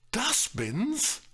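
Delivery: high-pitched rising question; astonished, bewildered query; incredulous echo. A narrator's reading. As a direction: repeat it in sheer bafflement. Bewildered echo in acted quarrel.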